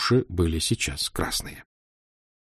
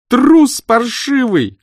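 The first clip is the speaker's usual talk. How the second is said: higher, louder, slow tearful yell; tearful shout; 8 dB louder, much slower than usual. A narrator's reading. Aglaya shouting down the stairwell at Senya, tearful. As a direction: scream it after him, near tears. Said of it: "крикнула она плачущим голосом".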